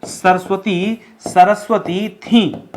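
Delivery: slow, deliberate reading of the corrected sentence; slow dictation. Shown as a demonstration. Teacher slowly reading the corrected sentence to students. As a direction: spell it out slowly for the class. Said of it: "क्या बोलना चाहिए".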